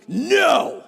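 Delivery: loud, high-pitched mock shout; shouted refusal. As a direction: bellow a sudden, hammed-up refusal. Mimics dad rejecting the other child.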